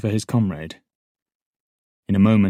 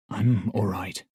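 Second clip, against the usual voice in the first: croaked; slower than usual. A narrator's reading. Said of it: "I croaked at last".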